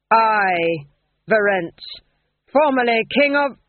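loud, raging ghost of a king; possessed ghost king. Acted. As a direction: accuse him in a furious dead king's voice. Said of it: "another personality stepped into him".

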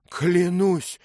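high, loud cry of an oath; startled oath. Acted. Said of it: "крикнул он".

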